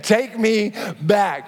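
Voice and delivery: ordinary talk, nothing marked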